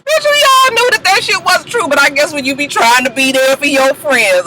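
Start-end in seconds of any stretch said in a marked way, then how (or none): 0.0-1.9 high sassy scoff; high mocking retort; sassy aside. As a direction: pitch up and call the bluff. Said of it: "I was just like".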